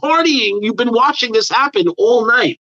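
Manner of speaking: high, exaggerated sarcastic scolding; hammed-up falsetto telling-off; mocking scold. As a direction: throw it back at her with a ridiculous high voice. Retold scolding of crying girl.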